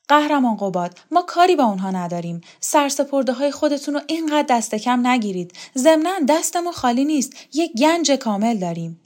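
acted eager insistence; persuading rebel woman. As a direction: win him over, quick and lifted.

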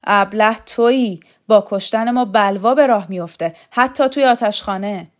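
loud defiant shouted retort. Acted. Shokat shouting back at Rakhov in defiance, loud. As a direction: throw the insult back at him.

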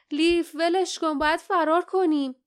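high, alarmed urging; urgent plea. A narrator's reading. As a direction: beg a friend to flee now. Companion urges escape from monster.

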